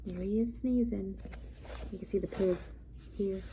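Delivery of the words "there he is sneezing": ordinary delivery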